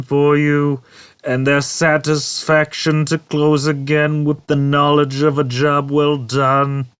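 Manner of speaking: high, bright, slow mock-cheerful ad voice; mimicking a sales brochure. Marvin mocking a sales brochure, sarcastic ad voice. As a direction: sell the doors like a sugary brochure. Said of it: "mimicked the style of the sales brochure".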